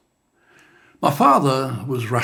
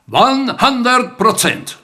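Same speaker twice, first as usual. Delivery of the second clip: louder, higher, slow proud finish; proud declaration; 10 semitones higher, 6 dB louder, much slower than usual. Acted. Son echoing his father's words to him, proud and loud. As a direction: ring it out as the poem's proud last line. Closing echo of dad's line.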